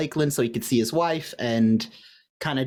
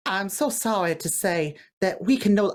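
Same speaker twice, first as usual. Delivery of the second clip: slow, higher Southern drawl on a letter; Southern accent; 5 semitones higher than usual. Retold or imitated. Lover reading a breakup letter, in a slow Southern accent. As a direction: read it like a Southern sweetheart, drawn out. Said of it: "I made this Southern".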